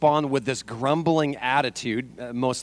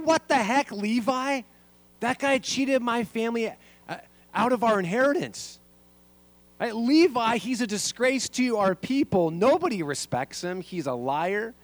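higher, indignant crowd voice, half-acted; grumbling crowd; 9 semitones higher than usual. Pastor voicing the crowd grumbling at Levi, mildly indignant. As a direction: play the resentful crowd muttering about Levi, lightly. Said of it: "I can only imagine what they were saying".

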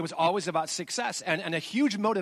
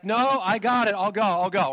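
insistent brush-off; 4 semitones higher, 6 dB louder than usual. Retold or imitated. Brushing off wife at the door.

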